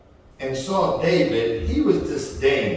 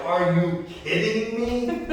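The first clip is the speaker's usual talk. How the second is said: high, disbelieving giant voice; mocking giant; 8 semitones higher than usual. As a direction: scoff at the tiny challenger. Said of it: "this giant is looking at him like".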